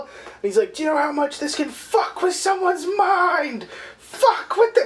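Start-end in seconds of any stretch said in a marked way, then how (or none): 0.7-3.7 higher, hammed-up panicked rant; imitating a doll losing it; freaking out. Chucky freaking out on set, panicked rant. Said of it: "Chucky's freaking out again". 4.0-4.8 much higher, cracking mock sob; freaking out, near crying. Chucky repeating himself while stabbing, cracking into sobs. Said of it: "Chucky's freaking out again".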